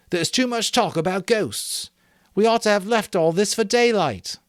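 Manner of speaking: put-on high, firm boy's voice; leader Ralph. A narrator's reading. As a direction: take charge.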